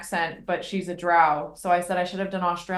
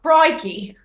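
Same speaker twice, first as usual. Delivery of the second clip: louder, higher mock Australian exclamation; a hammed-up Aussie crikey; Australian accent; 5 semitones higher, 6 dB louder than usual. Joking about the kobold crocodile hunter, mock Australian accent. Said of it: "worst accent possible".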